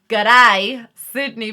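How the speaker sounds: loud, badly faked Australian g'day; hammed-up Australian accent on one word. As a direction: punch out a mock-Aussie greeting. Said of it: "not gonna stop doing that now".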